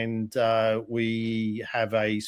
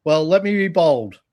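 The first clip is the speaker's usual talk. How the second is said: louder, building up to a bit; bold announcement; 5 semitones higher, 6 dB louder than usual. Pretend. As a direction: announce it boldly. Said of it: "in as bold and as loud a fashion as possible".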